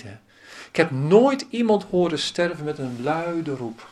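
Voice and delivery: ordinary talk, nothing marked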